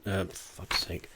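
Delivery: low, quiet annoyed mutter. Real, not acted. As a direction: grumble it under your breath. Mutters at own slip.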